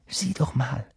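soft, breathy urging; hushed and eager; urgent whisper. Acted. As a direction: whisper to a friend to look. Urging friend to look, in secret.

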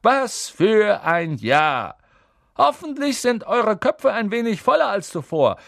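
high, loud festive speech; jovial old headmaster proclaiming; exuberant. A narrator's reading. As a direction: address the whole hall with merry pomp. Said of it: "rief Dumbledore ausgelassen".

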